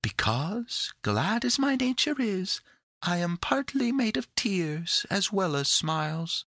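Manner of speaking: high, sweet little fairy voice; Hope. A narrator's reading. The winged creature explains her nature to the children, high and sweet. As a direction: explain gently, pitched well up.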